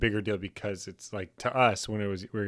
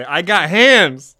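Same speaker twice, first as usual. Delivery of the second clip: louder, slow, higher tough-guy boast; 8 semitones higher, 15 dB louder, much slower than usual. Pretend. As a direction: belt it out like a brawler.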